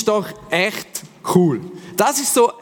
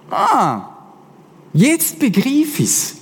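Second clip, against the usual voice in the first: dawning realisation; 8 semitones higher, much slower than usual. Retold or imitated. Ethiopian realises the gospel.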